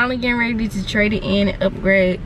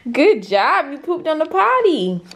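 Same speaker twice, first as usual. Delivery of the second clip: potty training praise; 7 semitones higher than usual. Real, not acted. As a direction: cheer him on like a proud parent. Cheering toddler's potty success.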